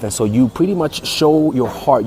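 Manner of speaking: normal talking voice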